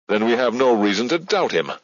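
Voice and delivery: closing the matter. A narrator's reading. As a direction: end the discussion firmly. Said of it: "There was closure in his voice".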